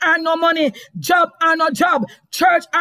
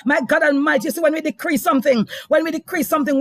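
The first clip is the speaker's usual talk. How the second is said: normal talking voice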